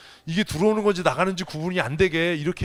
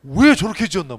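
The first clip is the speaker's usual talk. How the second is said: higher, much louder incredulous outburst; loud bafflement; 7 semitones higher, 12 dB louder than usual. Retold or imitated. Preacher mocking a shop name to the congregation, loud incredulous outburst. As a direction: blurt out your disbelief at the shop sign. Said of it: "나는".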